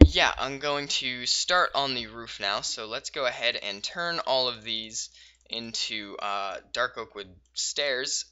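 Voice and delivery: usual speaking voice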